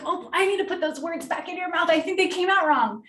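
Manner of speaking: playful redirect. Shown as a demonstration. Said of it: "I'm like".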